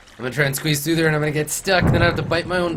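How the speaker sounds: mock panic. Jokingly panics about getting stuck.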